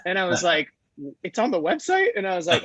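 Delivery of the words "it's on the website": high-pitched astonished question; incredulous echo. Retold or imitated. Founder retells hearing his number is online, high incredulous echo. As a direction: echo it back in mock disbelief, voice shooting up.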